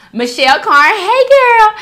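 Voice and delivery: high, loud sing-song hello; excited greeting. Real, not acted. Greeting a commenter by name, high and excited. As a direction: call out a bright hey.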